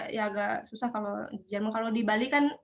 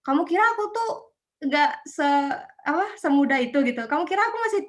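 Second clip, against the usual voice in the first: higher and louder mock indignant retort, rushing at the end; retold angry retort; 4 semitones higher, 5 dB louder, faster than usual. Offended elder refusing a train seat, mock angry retort. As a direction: snap back like an offended elder, half acted. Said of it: "Kadang tuh ada yang marah gitu".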